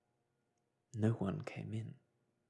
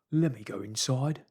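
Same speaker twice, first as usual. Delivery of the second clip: higher, louder plea to get in; frightened Marvel, urgent; begging fugitive; 4 semitones higher, 8 dB louder than usual. Acted. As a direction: plead to be let in.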